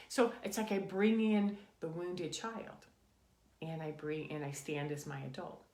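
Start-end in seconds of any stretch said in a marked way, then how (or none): none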